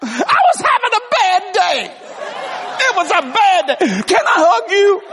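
put-on high, loud, teary-happy girl's voice with laughter; laughing mock girl asking for a hug; imitated waitress. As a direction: play the grateful waitress, overcome. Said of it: "She goes".

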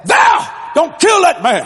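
high, drawn-out shout; urgent plea. Retold or imitated. Daddy begs mama not to shoot, urgent shout. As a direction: imitate a husband yelling to stop her.

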